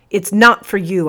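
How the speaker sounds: hissed. A narrator's reading. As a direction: hiss it sharply at the sergeant. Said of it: "I hissed".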